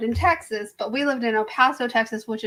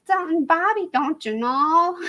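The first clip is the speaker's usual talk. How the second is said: Bobby's mom; 6 semitones higher than usual. Retold or imitated. Cartoon mom talking to her son, higher Minnesota accent. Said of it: "his mom used to talk on there".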